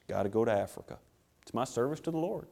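put-on weary self-pity, voice sagging; mock martyred sigh. Would-be missionary announcing his sacrifice for sympathy, mock-woeful. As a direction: sigh heavily, then very low and soft.

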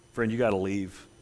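ordinary talk, nothing marked

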